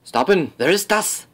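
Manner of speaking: loud, high fake German halt calls; hammed-up German sentry yelling; mock German shouts.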